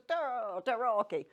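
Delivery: loud, emphatic Italian tarocchi; attempted Italian. Shown as a demonstration. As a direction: say "tarocchi" with hammed-up Italian gusto and a k sound. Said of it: "got to do the hands with it".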